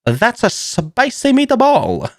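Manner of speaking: high, slow mock mobster "spicy meatball"; mock spicy surprise. Tasting the hot sauce, a high slow hammed-up reaction. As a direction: ham up the first taste, slowly and high. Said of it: "I was like".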